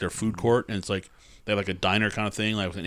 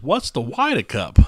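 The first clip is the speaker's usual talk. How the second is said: put-on thick New Jersey accent; slow, thick East Coast drawl; 4 semitones higher, 6 dB louder than usual. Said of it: "in this full, thick East Coast accent".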